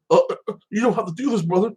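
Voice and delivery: high-pitched, whimpering imitation; mock wimpy pleading. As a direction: plead like a pathetic weakling, mockingly. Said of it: "like a little bitch".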